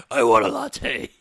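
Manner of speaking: slow, loud Batman impression; Bale Batman voice. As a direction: play it like a caped crusader, slow. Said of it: "with Bale Batman-y voice".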